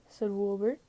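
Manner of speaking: plain reading voice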